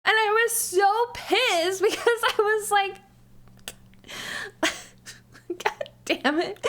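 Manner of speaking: high-pitched laughing exasperation. Real, not acted. Streamer recalling being pissed at work, laughing. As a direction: break into laughter while venting. Laughing at her own annoyance.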